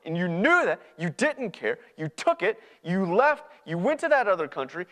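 loud, high, pounding list of wrongs; angry accusation. Acted. Older brother accusing the one who left, angry. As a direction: hammer each charge at him. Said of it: "I'm angry".

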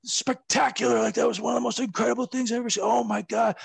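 high, excited, gushing rave; gushing Pat Patterson. Retold or imitated. Pat Patterson gushing to the wrestler, high excited rave. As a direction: rave about the spot like an overjoyed fan. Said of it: "he came and he was like".

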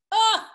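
a sudden high, loud "ah"; cry of glee. Acted. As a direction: burst out with a cruel thrill. Bella strikes with relish.

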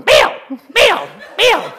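high, loud squawked name like a parrot; a talking bird copying the mother. Retold or imitated. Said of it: "the bird starts going".

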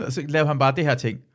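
normal talking voice

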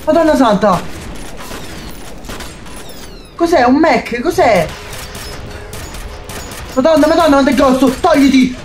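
scared, urgent cries; frightened yelling. Real, not acted. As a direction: yell in real fright while fighting. Fighting the giant mech.

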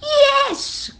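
very high witch's "yes"; delighted story witch calling out high; witch's cry. A narrator's reading. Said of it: "cried the witch".

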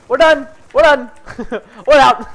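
high, loud calls breaking into laughter; exasperated shouting. Real, not acted. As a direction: shout the retreat, half laughing. Panicked retreat, laughing.

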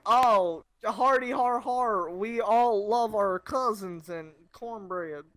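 slow, hammed-up Southern American drawl; mock American South accent, drawn out. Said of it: "do an American accent".

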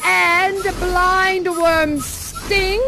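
second witch. Acted. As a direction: intone the ingredients as a spell. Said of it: "now the second witch".

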